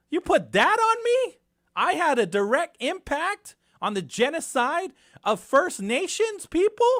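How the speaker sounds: loud, high, incredulous outburst; indignant shouting. Real, not acted. Radio host rails at being blamed for genocide, indignant shouting. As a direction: shout it in stung disbelief, dragging the key words. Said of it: "Sorry, I'm shouting".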